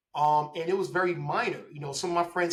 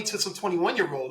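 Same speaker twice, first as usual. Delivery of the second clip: ordinary delivery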